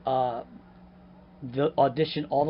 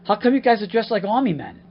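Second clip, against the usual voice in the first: loud, higher-pitched incredulous question; acted challenge; 7 semitones higher, 8 dB louder than usual. Misdressed actor to men in fatigues, loud challenge. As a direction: confront the room in disbelief.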